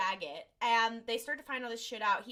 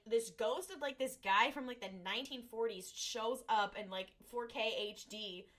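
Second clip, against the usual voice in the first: ordinary delivery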